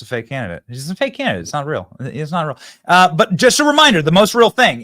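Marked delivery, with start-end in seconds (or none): 2.9-4.8 loud announcement. Real, not acted. Loud sign-off reminder to audience.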